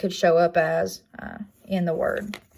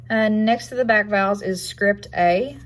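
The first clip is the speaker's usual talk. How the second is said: usual speaking voice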